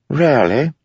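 cheeky jester. Acted. Wit retorts.

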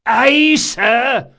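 very high, loud acknowledgement; cried reply. A narrator's reading. Sailing master to captain, cried out. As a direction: holler back at the captain. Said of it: "cried Caldris".

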